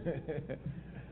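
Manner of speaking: low, soft chuckle; brief quiet laugh at himself. Real, not acted. Chuckles after fumbling the convention's name.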